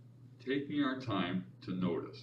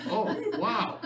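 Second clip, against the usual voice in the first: mock wow; 13 semitones higher, 6 dB louder than usual. Mock delight at own ideas.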